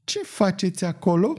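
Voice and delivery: high, squeaky question from behind; high-pitched busybody challenge. A narrator's reading. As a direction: pipe it up thin and high. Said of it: "rostite în spatele lui de un glas pițigăiat".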